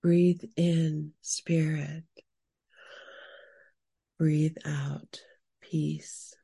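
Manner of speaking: breathy meditation whisper. Acted. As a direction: whisper the breath cues slowly. Breathing cues in guided meditation.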